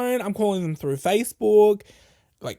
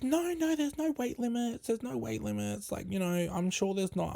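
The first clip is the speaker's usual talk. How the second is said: soft, higher voice of coaxing relatives; imitated reassurance; 4 semitones higher, 10 dB quieter than usual. Family coaxing her onto rides, soft mock reassurance. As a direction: imitate them softly, starting high and easing down.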